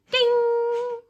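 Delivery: high, loud bell ding. A narrator's reading. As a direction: ring it out like a school bell. Bell chime imitated.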